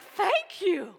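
high bright sing-song thank you; mock sweet thanks. Customer thanking a cheerful server, high mock-sweet tone. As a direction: echo the server's cheeriness back. Mimicking cheerful reply back.

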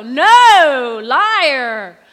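loud, scornful "liar"; scoffing shout. Acted. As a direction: bark it like a heckler. Congregation's doubting retort.

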